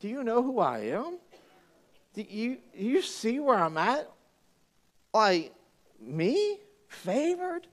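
Mary doubting she is chosen; mock disbelief. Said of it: "And she's like".

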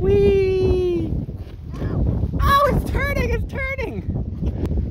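high thrilled squeal; excited squeal. Real, not acted. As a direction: squeal with delight as it spins. Ride spinning.

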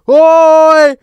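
put-on high, drawn-out accented yelling; imitated belligerent bellowing; mock threatening shout. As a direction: holler a threat across the street. Imitated man yelling threats outside.

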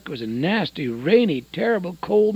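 ordinary talk, nothing marked

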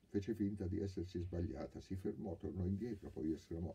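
usual speaking voice

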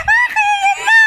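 high, loud panicked cry; panicked shout. Real, not acted.

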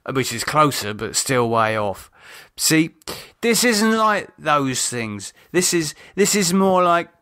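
lightly voiced man, resentful and weary; David, bitter. A narrator's reading. David explains his family.

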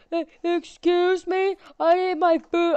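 high, slow put-on voice of an impatient customer; demanding customer. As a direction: whine it like a pushy diner. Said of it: "they're like".

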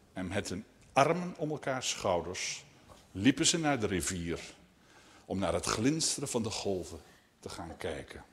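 gentle, hushed story ending; story narration. A narrator's reading. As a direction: let the tale settle quietly to its end. Reads a story to children.

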